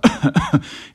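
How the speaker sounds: put-on loud old man's cough; acted cough. A narrator's reading. Grandfather interrupted while talking, loud acted cough. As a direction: break off to cough in character.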